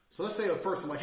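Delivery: usual speaking voice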